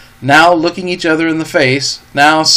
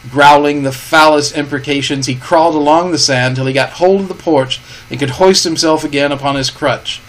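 plain reading voice

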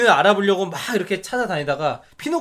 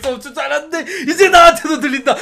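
put-on overjoyed old man crying out, high and loud; laugh-crying with joy like an old man; 8 semitones higher, 8 dB louder than usual. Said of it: "그 할아버지 연기 되게 좋았던 것 같아".

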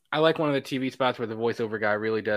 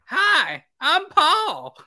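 squeaky cartoon voice; 6 dB louder, much slower than usual. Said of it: "It's like that monkey".

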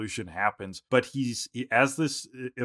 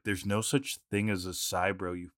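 low, quiet, deadpan put-down; stone cold; 5 semitones lower, 7 dB quieter than usual. Retold or imitated. Host imitating Brock scolding Ash, stone cold. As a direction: drop it low and soft, utterly cold. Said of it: "like, stone cold".

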